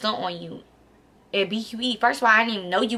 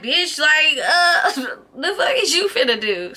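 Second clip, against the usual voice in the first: higher and louder, half-laughing disbelief; incredulous yell; 6 semitones higher, 3 dB louder than usual. Retold or imitated. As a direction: yell it like you can't believe she's coming at you. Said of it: "'cause I'm like".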